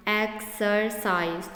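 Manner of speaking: Exercise in three separate beats, "ex - er - cise"; split into syllables. Shown as a demonstration. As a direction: say exercise with a break after each syllable. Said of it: "three यहाँ पे syllable हैं". (Exercise को तीन हिस्सों में तोड़कर)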